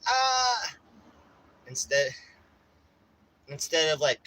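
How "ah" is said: high, loud short scream; mock scream. Victim still dialing, high mock yelp. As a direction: yelp like you've been attacked. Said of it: "you're already like".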